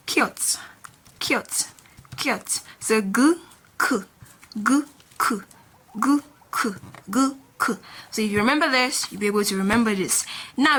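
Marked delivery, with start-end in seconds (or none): 0.1-2.6 kiot said alone, short and crisp with pauses; letter-name demo. Shown as a demonstration. Teacher naming the K consonant for learners, letter-name demo. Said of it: "It is called". 3.0-7.8 gu and ku as short alternating syllables; consonant drill. Shown as a demonstration. Teacher contrasting G and K sounds, consonant drill. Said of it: "The K sound".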